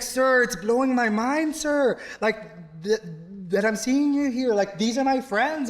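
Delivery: high, eager, respectful excitement; starstruck student. Retold or imitated. Student tells his teacher he can't believe it, high and eager. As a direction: gush politely at your old teacher. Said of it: "And he's like".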